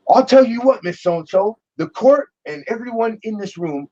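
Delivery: high, pointed mock rebuke; stern rebuke. Judge rebuking the clerk, mock stern rebuke. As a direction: lay down the law to her.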